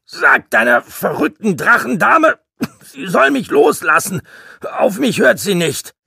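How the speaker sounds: high, loud, exasperated rant; indignant, shouted complaint; scolding. A narrator's reading. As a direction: scold at full voice. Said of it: "schimpfte eine Stimme".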